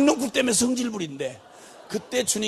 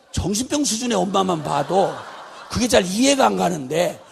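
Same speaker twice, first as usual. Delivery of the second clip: normal talking voice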